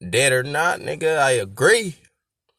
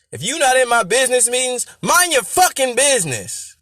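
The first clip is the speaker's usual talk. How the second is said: high-pitched, animated scolding; acted high indignant outburst; indignant telling-off; 9 semitones higher than usual. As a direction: tell them off, pitched way up. Voices the rapper telling someone off.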